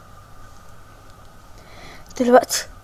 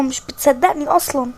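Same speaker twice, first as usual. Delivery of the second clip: ordinary talk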